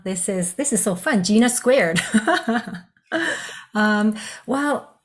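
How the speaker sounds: usual speaking voice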